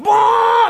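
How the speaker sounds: very high, loud mock burst of fury; cartoon steam burst. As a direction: blow off steam like an angry cartoon. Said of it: "the steam comes out".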